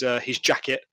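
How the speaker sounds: ordinary talk, nothing marked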